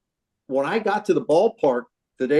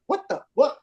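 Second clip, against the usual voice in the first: stunned disbelief; 10 semitones higher than usual. Retold or imitated. Pitcher retelling the moment he saw Strawberry, stunned disbelief. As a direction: jump high in sudden shock, words tumbling.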